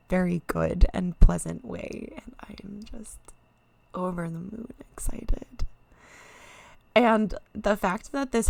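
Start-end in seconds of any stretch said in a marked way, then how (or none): 2.2-5.7 breathless whisper, barely voiced; whispered joy. Real, not acted. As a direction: hush it out like a secret thrill. Overwhelmed streamer whispering her joy.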